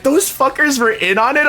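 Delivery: high, rising disbelieving question; incredulous mock outrage. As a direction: ask it high, as playful outrage. Repeats friend's incredulous reaction.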